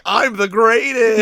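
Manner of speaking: loud, slow mock villain boast; hammed-up triumphant cry; grandiose boasting. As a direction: proclaim it, stretching every word. Kraven boasts atop the elephant.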